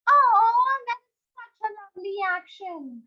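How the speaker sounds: high, gushing delight; delighted praise. Real, not acted. Teacher praising a child's swimming action, delighted. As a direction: burst out loud on Oh, then gush.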